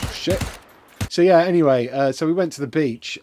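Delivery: ordinary delivery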